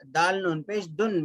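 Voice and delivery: ordinary talk, nothing marked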